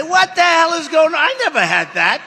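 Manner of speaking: loud, high, retold outburst; mock outraged shout. Telling the crowd how he reacted, high outraged shout. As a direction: shout it like you can't believe what you're seeing.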